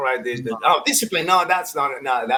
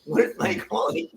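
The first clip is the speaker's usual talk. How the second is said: mock bewildered player; 13 semitones higher than usual. Acting out the confused player.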